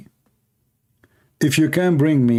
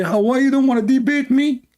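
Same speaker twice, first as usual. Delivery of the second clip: squeaky high mocking voice of the challengers; mocking whiny challenger; 13 semitones higher than usual. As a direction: mimic them in a shrill-pitched taunt. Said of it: "And then they say".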